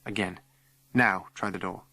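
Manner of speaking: ordinary delivery, nothing marked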